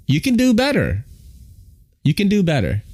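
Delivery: high pitch swooping down; mock exhortation. Real, not acted. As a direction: urge them on in a comically high voice. Mock coaching of transit agency.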